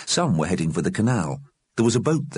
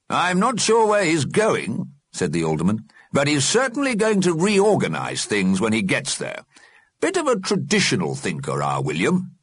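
put-on old alderman voice; 9 semitones higher than usual. Alderman comments.

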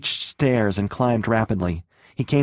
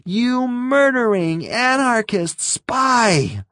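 very high, slow screamed accusation; frenzied high-pitched screaming; furious scream; 13 semitones higher, 3 dB louder, slower than usual. A narrator's reading. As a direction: shriek it out, stretching the words. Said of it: "Green screamed".

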